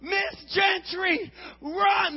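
high, loud, with a drawn-out Miss Gentry; loud alarmed warning. Retold or imitated. Boys warning neighbor Miss Gentry, loud alarmed shout. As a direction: yell for her to run.

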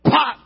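shouted firework pop; loud mock pop. Comic acting out fireworks for the audience, loud popping.

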